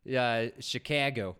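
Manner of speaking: slow, drawn-out Chicago with a cat-like a; loud, casual local guy impression; lightly imitated maintenance dude. Imitating the maintenance dude at an apartment, lightly put-on local voice. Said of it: "the maintenance dude to Marissa's apartment".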